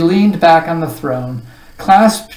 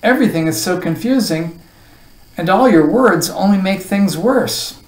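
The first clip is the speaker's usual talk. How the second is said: higher-pitched, frustrated complaint; protesting; 5 semitones higher, 4 dB louder than usual. A narrator's reading. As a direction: protest in growing confusion. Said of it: "protested Milo".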